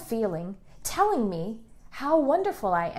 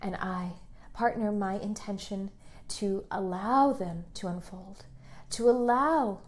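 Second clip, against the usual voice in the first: hushed meditative prayer voice; guided meditation; 3 semitones lower, 10 dB quieter than usual. Acted. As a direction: breathe the words to them gently. Leads a guided affirmation meditation.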